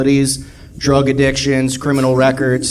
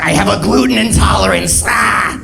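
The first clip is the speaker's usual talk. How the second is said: strained monster shout into a growl; hammed-up transformation howl; werewolf customer; 4 semitones higher than usual. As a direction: roar the complaint like a werewolf. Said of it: "Starts growing claws".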